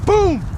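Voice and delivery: high, explosive spoken boom; loud crash boom. Retold or imitated. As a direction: punch out the impact sound. Imitates car hitting the hill.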